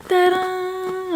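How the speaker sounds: high, held-out reveal fanfare; drawn-out presenter's flourish; playful ta-da. Real, not acted. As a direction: sing out a long, high ta-da.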